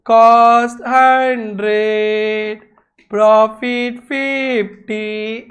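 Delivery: very slow, word by word; slow teacher dictation. Shown as a demonstration. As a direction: spell it out slowly for the class. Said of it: "teacher spells out slowly".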